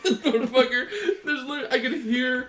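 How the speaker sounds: high cracked laughing voice; laughing and crying. Real, not acted. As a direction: laugh through tears. Nose running from heat, laughing.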